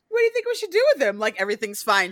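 put-on high, fast, breezy girl voice; childlike mock-innocent voice; mock Claudia. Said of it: "she just looks at Louis like".

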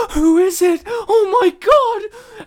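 high, loud hammed-up scream of fright; a shocked woman screaming at the door; mock frightened cry. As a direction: shriek it like a horror victim. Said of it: "and they're like".

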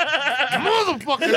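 high, drawn-out mock curse; retold pained cursing. Acting out the wounded friend cursing, pained outburst. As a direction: imitate cursing in pain, half laughing. Retelling a man shot in the foot.

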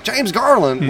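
high, delighted cry of his name; light up at hearing his name; excited greeting. Retold or imitated. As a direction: bar owner excitedly recognizing him. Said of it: "I hear a woman get excited".

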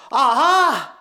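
loud, high cry of discovery; acted eureka shout; sudden revelation. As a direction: burst out as if it just clicked. Acts man's sudden gospel revelation.

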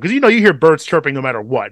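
normal talking voice

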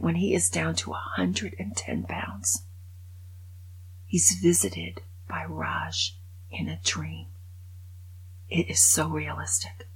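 hushed grave whisper; hushed storytelling. Real, not acted. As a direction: drop to a whisper for the sad part. Dream scene told hushed.

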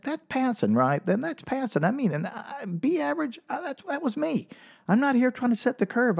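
high-pitched, rambling mock panic; nervous pleading. Comic replaying bargain with doctor before exam, high-pitched nervous pleading. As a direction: plead your way out, voice climbing high.

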